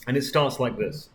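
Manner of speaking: ordinary delivery, nothing marked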